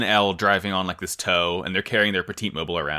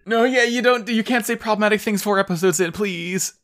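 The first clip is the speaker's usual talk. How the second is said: excited scold; 12 semitones higher, 3 dB louder than usual. Real, not acted.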